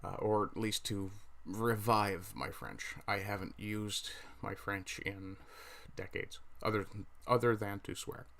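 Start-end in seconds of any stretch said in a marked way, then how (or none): none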